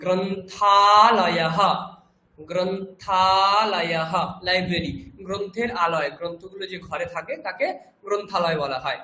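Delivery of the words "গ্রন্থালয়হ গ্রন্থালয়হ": গ্রন্থালয়হ drawn out very slowly, with a breathy হ for the visarga; teacher modelling the Sanskrit word গ্রন্থালয়হ slowly for students; Sanskrit pronunciation. Shown as a demonstration. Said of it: "তারপরে বিসর্গ".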